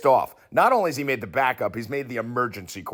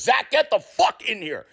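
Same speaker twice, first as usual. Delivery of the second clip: mock angry coach; 10 semitones higher, 3 dB louder, slower than usual. Coach Saleh furious, summoning Zach.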